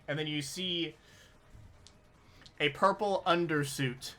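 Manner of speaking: ordinary talk, nothing marked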